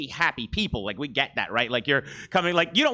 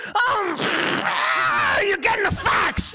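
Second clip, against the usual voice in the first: Bobcat Goldthwait as a fax machine; much slower than usual. Pretend. Comedian doing Bobcat Goldthwait as a fax, high and shrieking. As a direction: screech like an old fax line. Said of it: "This is Bobcat Goldthwait as a fax machine".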